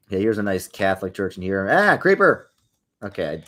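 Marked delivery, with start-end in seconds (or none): 1.7-2.5 sudden high, loud yelp of alarm; startled yelp. Real, not acted. Gaming on stream, caught off guard by a creeper, startled yelp. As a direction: jump at the creeper.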